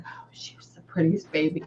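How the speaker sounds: soft whisper, voiceless; whispered quote. Retold or imitated. Medium relays the father's words to his daughter, whispering. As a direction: whisper the spirit's words to the sitter.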